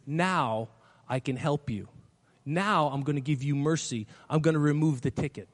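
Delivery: a judge speaking kindly, quietly; gentle mercy. Retold or imitated.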